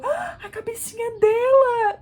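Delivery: high, slow, teary delight; doula moved. Retold or imitated. Doula seeing the baby's head, high and moved. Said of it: "a Doula falou".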